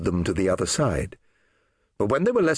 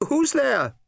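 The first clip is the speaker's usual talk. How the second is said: high, wary call into the dark; calling out nervously into the cellar; fearful call. Acted. Woman calling down into the dark cellar, fearful.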